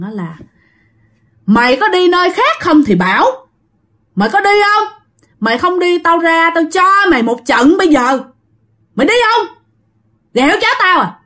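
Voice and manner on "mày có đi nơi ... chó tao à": put-on high-pitched scolding of an older woman; middle-aged woman scolding. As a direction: act out a woman threatening to chase off an intruder. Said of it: "là đàn bà cỡ, à, năm, năm mươi năm mấy tuổi kêu rằng". (giọng bà đứng tuổi quát đuổi, cao giọng)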